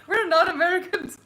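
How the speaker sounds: high laughing protest. Real, not acted. Laughing denial of being American.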